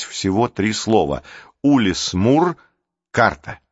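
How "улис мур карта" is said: plain reading voice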